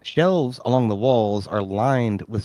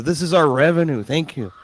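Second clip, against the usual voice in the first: a hint of the farmer; 5 semitones higher, 5 dB louder than usual. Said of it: "He says".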